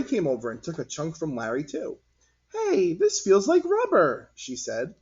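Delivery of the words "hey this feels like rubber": put-on very high, slow, curious kid; child voice. Cory feeling the gloop, very high child voice. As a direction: wonder at it like a little girl.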